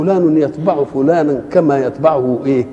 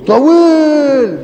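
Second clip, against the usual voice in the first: drawn out; 10 semitones higher, 4 dB louder, much slower than usual. Acted. Preacher showing the long morning shadow, drawn out high. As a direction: stretch the word slowly and high.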